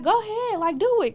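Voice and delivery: high, taunting dare; daring. Retold or imitated. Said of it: "So I was like".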